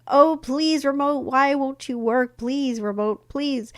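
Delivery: begging a broken remote to work. Retold or imitated. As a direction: whine at the remote like a kid begging. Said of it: "I wasn't just like".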